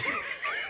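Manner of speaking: high laugh. Real, not acted. Laughs at own joke.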